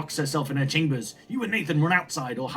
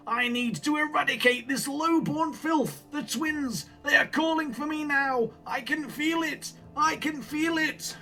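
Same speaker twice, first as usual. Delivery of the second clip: high, slow zealot's rant; mad grandfather, fanatical; 12 semitones higher, slower than usual. Acted. Grandfather vows cleansing fire to mother, high, slow raving. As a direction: command and rave in drawn-out high tones.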